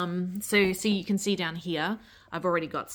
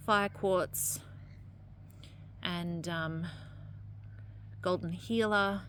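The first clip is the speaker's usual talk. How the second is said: ordinary talk, nothing marked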